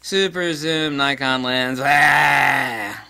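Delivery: loud, slow exasperated groan; mock groan. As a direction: groan at yet another zoom lens. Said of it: "I was like".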